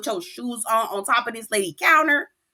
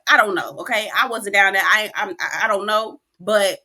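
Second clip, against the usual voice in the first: usual speaking voice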